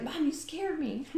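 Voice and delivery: put-on high, slow little-girl voice, startled; lightly mock a spooked kid; imitating startled daughter. Mom retells her daughter's startled complaint, high and slow. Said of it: "She's like".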